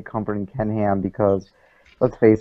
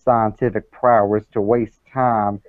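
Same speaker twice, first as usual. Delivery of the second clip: put-on Southern accent. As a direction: read it as a Southern creationist fan. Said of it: "the accent was just not".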